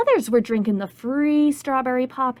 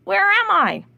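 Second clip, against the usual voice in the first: croak, then high puzzled question; baffled frog in midair; bullfrog voice; 5 semitones higher, 4 dB louder than usual. Acted. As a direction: croak and wonder aloud. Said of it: "He said".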